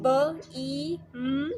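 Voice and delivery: ब, ई, म spoken one sound at a time; sounding out beam. Shown as a demonstration. Teacher sounding out a dictionary word sound by sound. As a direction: split बीम into its separate sounds. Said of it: "बीम की देखें".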